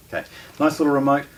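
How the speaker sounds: ordinary talk, nothing marked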